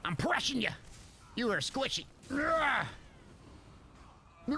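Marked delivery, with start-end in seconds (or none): 2.3-4.6 screaming. Real, not acted. Streamer mid-fight, yelling at the screen. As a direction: let out a raw frustrated yell. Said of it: "I don't know why I'm screaming".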